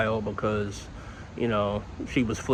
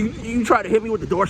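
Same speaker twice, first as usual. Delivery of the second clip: much higher stunned question; disbelief; 11 semitones higher than usual. Retold or imitated. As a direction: ask in disbelief, pitched up. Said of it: "I was like".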